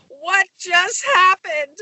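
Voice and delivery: high, slow, mock-stunned question; stunned disbelief. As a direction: act out bewildered shock, drawn out. Retold shock after the event.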